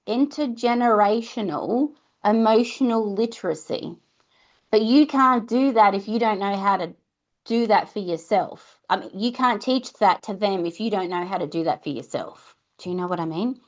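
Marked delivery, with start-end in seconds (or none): none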